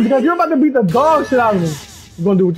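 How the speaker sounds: ordinary delivery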